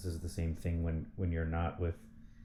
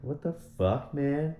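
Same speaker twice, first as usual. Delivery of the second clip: exasperated; 7 semitones higher, much slower than usual. Real, not acted. Exasperated outburst.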